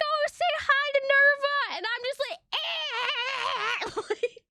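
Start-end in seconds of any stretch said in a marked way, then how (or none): 0.0-1.8 high, slow mock mom voice; exaggerated high-pitched prompting; imitated mom. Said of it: "She's like". 2.5-4.0 high-pitched mock wail; hammed-up bawling cry breaking into laughter; imitated bawling. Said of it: "I just start bawling".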